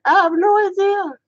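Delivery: very high, laughing squeal; high-pitched mock-baffled squeal; squealed protest. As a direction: repeat it in a squeaky, helpless giggle. Repeats baffled reply while laughing.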